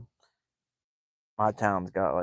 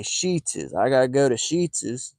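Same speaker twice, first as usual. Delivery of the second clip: higher, "Sheetz's" with an added "-iz"; mock local "Sheetz's"; 3 semitones higher, slower than usual. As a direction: imitate locals naming the gas station. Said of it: "They say".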